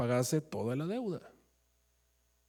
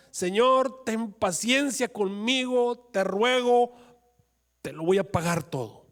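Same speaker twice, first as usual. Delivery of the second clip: high, slow desperate begging; pleading servant; 7 semitones higher, 4 dB louder, slower than usual. Acted. Servant kneeling before the king, begging high and slow. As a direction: beg the king for patience on your knees.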